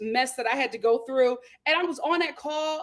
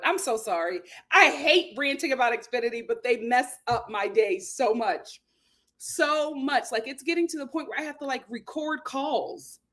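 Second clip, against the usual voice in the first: ordinary delivery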